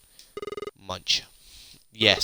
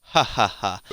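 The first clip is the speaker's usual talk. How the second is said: mocking laugh; 5 dB louder than usual. Mocks the small secret.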